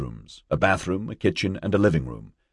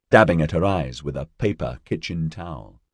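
plain reading voice

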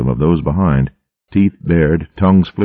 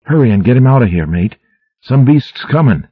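fast, higher, louder urgent warning; urgent call; 8 semitones higher, 3 dB louder than usual. A narrator's reading. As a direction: hurry them out before the enemy arrives. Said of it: "Grath called urgently".

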